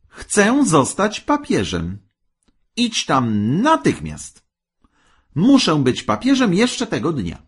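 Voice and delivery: high, insistent commands; demanding wife. A narrator's reading. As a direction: order him off, imperious and impatient. Wife orders husband to go.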